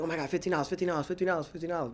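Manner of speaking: lightly mocked panic; mock freak-out. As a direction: imitate people fretting, half under the voice. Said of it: "really freaking out about minimum wage".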